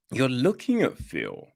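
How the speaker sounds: ordinary delivery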